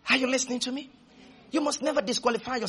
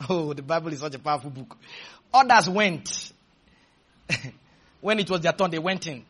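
ordinary talk, nothing marked

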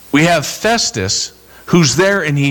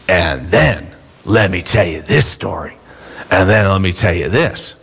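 lower, sly voice of Satan whispering in your head; tempting Satan voice; 8 semitones lower than usual. Retold or imitated. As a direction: tempt them like the devil in their ear. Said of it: "Satan in your head going".

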